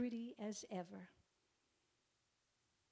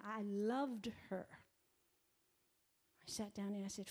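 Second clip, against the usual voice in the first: ordinary talk, nothing marked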